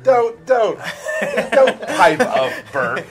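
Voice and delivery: high, loud laughing shout; mock-outraged laughing yell at a teammate; laughing protest. Real, not acted. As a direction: laugh while telling him to hush. Teasing co-host who interrupts him.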